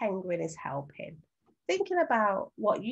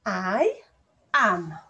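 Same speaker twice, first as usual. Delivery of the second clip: each word said alone with long gaps, louder; sounding out word by word; 2 semitones higher, 6 dB louder, much slower than usual. Shown as a demonstration. Teacher sounding out the sentence for children to write. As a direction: stretch the sentence out for spelling. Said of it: "Let's sound out the first syllable".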